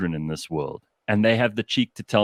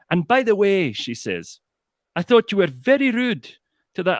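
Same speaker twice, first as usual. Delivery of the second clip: high, firm Glaswegian woman scolding; 9 semitones higher, 3 dB louder than usual. Retold or imitated. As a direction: put the huffy man in his place, high and firm. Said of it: "she went".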